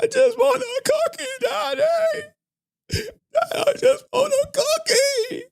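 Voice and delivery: put-on very high-pitched whiny little-kid wail; imitating crying daughter. Daughter begging dad for a cookie, mock crying. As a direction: put on a squealing child's tearful plea. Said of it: "I don't care about your crying".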